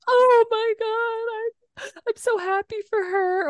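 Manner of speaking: high, teary gushing; imitating a weepy reader; retold happy crying. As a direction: gush like you're about to cry happy tears. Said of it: "there was moments where I'd be like".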